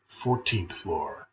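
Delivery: plain reading voice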